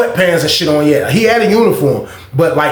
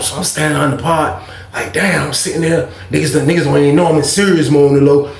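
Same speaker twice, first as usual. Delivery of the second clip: usual speaking voice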